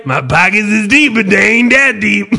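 lightly voiced babbling grandpa; mock old man blurting his catchphrase; senile grandpa quoting. Senile grandpa repeating his quote to family, hammed-up. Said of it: "Grandpa's saying funny things again".

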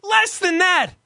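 loud, high indignant rant; outraged. Real, not acted. Complaining to his friend about the quote, loud outrage. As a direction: rant in disbelief at the price.

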